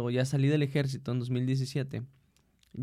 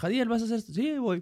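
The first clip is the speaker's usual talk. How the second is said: higher-pitched quick quote of boss and worker; imitating a willing worker, pitched up; acted-out order exchange; 8 semitones higher than usual. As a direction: lightly act out an order readily obeyed. Acts out taking an order.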